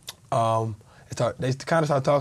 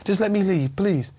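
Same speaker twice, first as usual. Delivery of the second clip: higher, pleading voice, begging to be let go; retold plea, voice pitched up in fear; desperate pleading; 6 semitones higher, slower than usual. To the robbers holding him, begging them to let him leave, pleading.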